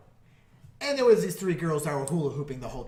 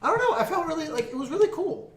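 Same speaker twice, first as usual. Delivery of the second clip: high, laughing excitement; excited; 12 semitones higher, 3 dB louder than usual. Real, not acted. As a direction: bubble up with glee.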